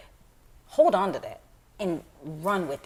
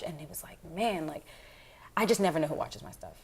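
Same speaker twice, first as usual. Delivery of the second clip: ordinary delivery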